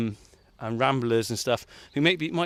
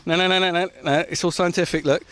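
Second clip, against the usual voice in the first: loud; 2 semitones higher, 8 dB louder than usual. Real, not acted. Bossing helper with shovel.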